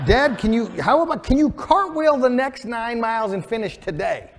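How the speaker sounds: put-on high, loud, eager kid voice pushing dad on; excited child plea. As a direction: burst out with a child's bright idea. Excited daughter urges dad on.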